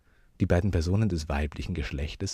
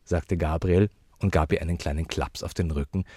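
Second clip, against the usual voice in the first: ordinary delivery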